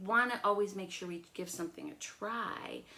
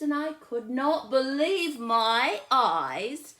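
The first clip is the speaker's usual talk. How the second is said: louder, slow, higher storytelling; building suspense; 9 semitones higher, 10 dB louder, slower than usual. A narrator's reading. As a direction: draw the kids in.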